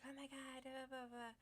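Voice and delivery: high, soft imitation of a kid's tirade; mock yelling. Kid yelling back at parents, high-pitched mock yell. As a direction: mimic a complaining kid without real volume. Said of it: "he's all yelling at his parents".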